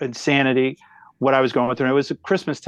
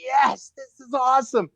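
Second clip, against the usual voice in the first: overjoyed shout; 10 semitones higher than usual. Retold or imitated. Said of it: "I'm like".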